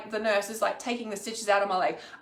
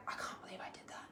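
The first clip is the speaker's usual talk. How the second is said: near-silent, disbelieving whisper; ashamed whisper; 22 dB quieter, faster than usual. Acted. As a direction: whisper it to yourself in shame. Voicing shame and regret to herself.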